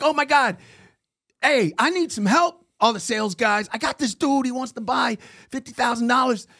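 panicked rookie yelling to his coworkers; excited call for help. Retold or imitated. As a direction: shout across the sales floor for backup.

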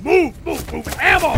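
loud urgent commands; shouting orders. Acted. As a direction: bark it over the noise. Urgent fight, ordering movement.

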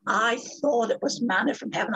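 ordinary delivery

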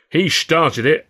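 a snarled excuse; growled. A narrator's reading. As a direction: growl it, defensive. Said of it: "I growled".

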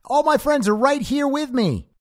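hammed-up shouted reply; mock scream back. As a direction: yell back as if from far behind. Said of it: "[name] starts screaming back".

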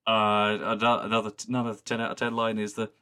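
ordinary talk, nothing marked

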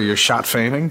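"shat faming" with first sounds swapped from fat shaming; jumbled syllables. Shown as a demonstration. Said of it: "Is that a spoonerism?".

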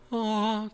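high, sustained "ah" like music; symphony-like "ah". Retold or imitated. Imagining hearing come back as a symphony, high held "ah". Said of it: "this beautiful symphony".

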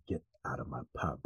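very low, hushed quote; quiet, low impression of a film line; low menacing mutter. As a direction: mutter it low under your breath. Acting out film character's line.

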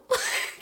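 a short squeal of a laugh, way up high; high burst of laughter. Real, not acted. Laughing at the creepy driver's loyalty claim. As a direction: laugh out at the absurdity.

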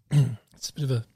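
strained voice after choking on a drink. Real, not acted. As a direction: speak through choking on a drink. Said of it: "choking there".

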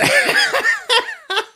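loud shrieking laugh; high cackle. Real, not acted. Host delighted by fart research, high loud cackle. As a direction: throw back a shrill cackle.

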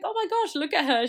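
high, cooing mock awe; gushing proud mum. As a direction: fawn over the child like a starstruck parent.

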